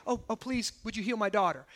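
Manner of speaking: soft, understated imitation of asking; mild polite plea. Imagined mother politely asking for healing, soft and mild. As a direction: ask meekly, without any real begging. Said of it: "It's not just like".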